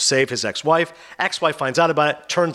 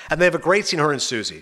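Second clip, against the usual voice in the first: normal talking voice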